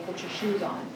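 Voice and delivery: soft, gentle put your shoes on; calm plain request. Shown as a demonstration. Mother showing the words without anger, soft gentle. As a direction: say it softly, no edge at all. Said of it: "nothing angry about saying".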